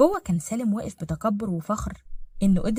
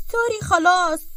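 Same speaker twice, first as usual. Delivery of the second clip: put-on high, louder kid voice; apologising child; 10 semitones higher, 5 dB louder, slower than usual. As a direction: give in like a scolded child. Kind entschuldigt sich widerwillig.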